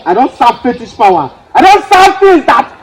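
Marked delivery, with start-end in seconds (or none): 1.5-2.8 defiant shout. Acted.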